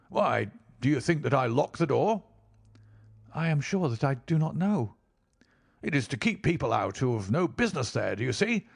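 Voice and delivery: smooth falsely friendly man; friendly on the surface, menace beneath; amiable villain. A narrator's reading. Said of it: "still smiling in that most amiable manner".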